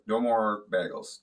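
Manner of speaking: normal talking voice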